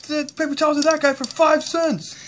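very high, loud outraged complaint; indignant protest. Pretend. Dad complaining to the seller, loud indignation. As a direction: protest the unfairness loudly.

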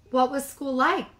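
a hint of an eager mama; cheerful mother. Mama greeting cubs from bus, bright.

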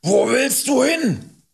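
loud, very high, drawn-out yell; shouting. A narrator's reading. Rider calls after fleeing boy, shouting. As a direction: yell it after someone leaving. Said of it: "schrie Hubert".

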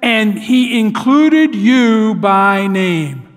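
very slow, weighty, each word placed; slow right down and land it on him; slow and deliberate. Acted. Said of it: "she slows down and stops and looks right at Peter".

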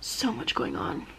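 soft tired whisper. Real, not acted. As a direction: whisper goodbye to viewers. Late night whispered sign-off.

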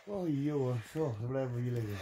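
winded and puffing after running; out of breath. Real, not acted. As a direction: catch your breath between words. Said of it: "ik ben kapot".

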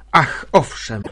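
loud, delighted cry of assent; joyful exclamation. A narrator's reading. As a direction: call it out with joy. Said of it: "zawołała z radością". (radosny okrzyk)

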